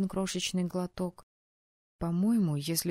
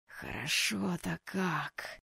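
strained, slow wheeze; wheezy; 4 dB quieter, much slower than usual. Acted. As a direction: wheeze it out after a stiff drink. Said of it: "просипел он".

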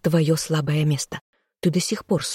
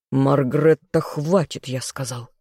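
ordinary delivery

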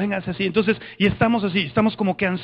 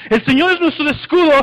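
raised, higher battle chant; cántico que sube; 5 semitones higher, 4 dB louder than usual. Shown as a demonstration. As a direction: chant it up high as the army joins in. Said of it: "y empieza a subir". (cántico de ejército, alto y firme)

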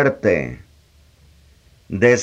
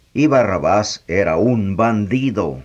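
ordinary delivery

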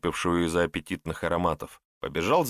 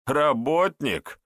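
slow, higher-pitched mocking echo; scoffing; 7 semitones higher, 3 dB louder, much slower than usual. A narrator's reading. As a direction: draw the word out in amused disbelief. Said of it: "усмехнулся собеседник". (насмешливо, врастяжку, с недоверием)

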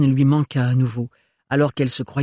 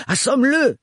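high, loud command; urgent high shout; shouted order; 12 semitones higher, 4 dB louder than usual. Acted. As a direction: bark it at him mid-fight. Gaël urges Renard in fight.

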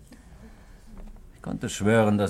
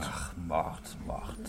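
scornful murmur; 4 semitones lower, 10 dB quieter, much slower than usual. Acted. Bitter dismissal of the word murder.

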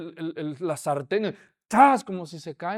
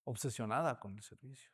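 tense hush; 9 semitones lower, 13 dB quieter than usual. Acted. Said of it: "Ella está así".

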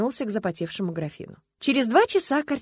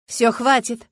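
commanding; 4 semitones higher, 8 dB louder, slower than usual. A narrator's reading. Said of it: "скомандовала Люба".

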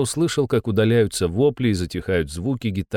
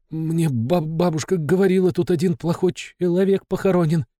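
trembling voice; 7 semitones higher than usual. Acted. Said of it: "дрожащим голосом прошептал Лёха".

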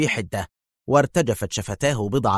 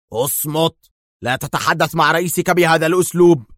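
raised, stern command, louder at the end; stern roar; 6 semitones higher, 4 dB louder than usual. A narrator's reading. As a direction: cut him off severely, voice pitched up. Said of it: "بصيحة هادرة صارمة".

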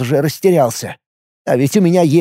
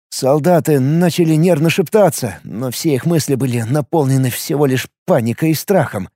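ordinary delivery, nothing marked

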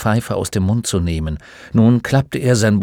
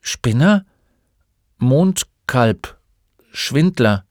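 higher-pitched, halting list; hesitant listing; 4 semitones higher, 3 dB louder, slower than usual. A narrator's reading. Boy lists his classmates' taunts to the old man, hesitantly. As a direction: recite each word reluctantly, pausing between. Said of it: "Bastian zögerte, ehe er aufzählte".

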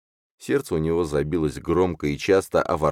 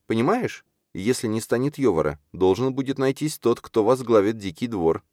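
high, urgent character voice; urgent Чарна; 9 semitones higher than usual. Acted. Чарна stops Юрген to warn about Йовар, urgent. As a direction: make the case earnestly, pitched well up.

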